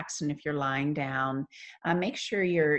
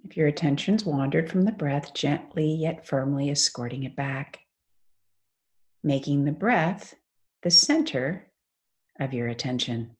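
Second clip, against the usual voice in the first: guided meditation. Acted. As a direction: ease them into stillness, phrase by unhurried phrase.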